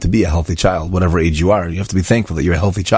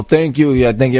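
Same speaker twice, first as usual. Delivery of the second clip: careless, higher mock thank you; offhand mock thanks; 6 semitones higher than usual. Mocking how most people thank Hashem, offhand and higher. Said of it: "Like most people".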